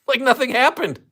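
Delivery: high, loud, laughing disbelief; incredulous laughing. Real, not acted. Host amazed by the man's calm, incredulous laughing. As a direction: say it through an astonished laugh.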